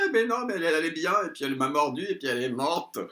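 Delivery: high, injured protesting voice; imitating him as he whines he's innocent; mock protest. Il se justifie, voix imitée. (voix aiguë d'homme qui se défend)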